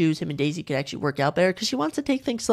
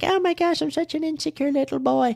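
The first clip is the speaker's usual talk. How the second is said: high-pitched mock-tearful voice; mock near-crying; 11 semitones higher than usual. Host mocks the Bachelor reading a note, hammed-up tearful voice. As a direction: imitate a boy about to cry, much higher. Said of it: "Joey's about to cry".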